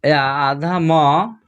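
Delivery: drawn-out teaching pronunciation of आधा म; slow demo of half m. Shown as a demonstration. Teacher to pupils, slow clear demo. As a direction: stretch आधा म slowly so the nasal m is heard. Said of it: "हम लोग आधा न और आधा म पढ़ते हैं".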